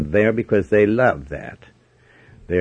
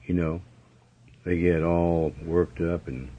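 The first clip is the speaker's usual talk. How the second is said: ordinary talk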